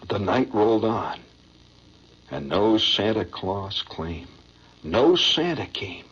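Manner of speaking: low, slow, sentimental recital; poem recitation. Acted. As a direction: recite it like a heart-tugging stage ballad.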